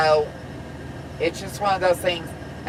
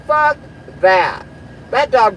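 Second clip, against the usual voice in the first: sharp high burst, louder; angry outburst; 6 semitones higher, 5 dB louder than usual. Real, not acted. Trucker venting at a rude driver, angry. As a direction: spit it out in disgust.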